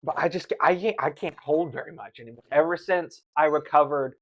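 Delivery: ordinary talk, nothing marked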